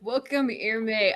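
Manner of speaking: ordinary talk, nothing marked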